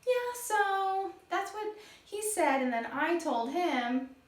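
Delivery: light gossipy chat voice; chatty talk. Shown as a demonstration. Teacher acting out chatting for the class. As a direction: chat as if telling a friend.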